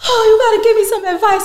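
high, fast mock plea; a lightly voiced begging friend; imitating friend. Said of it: "She's like".

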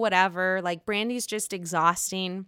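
ordinary delivery

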